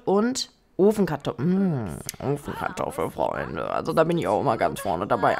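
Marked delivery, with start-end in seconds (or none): 1.4-5.4 low, relishing food-lover's voice; savouring. Real, not acted. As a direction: drop deep down, savouring the favourite dish. Savours the thought of baked potato.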